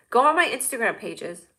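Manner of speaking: ordinary talk, nothing marked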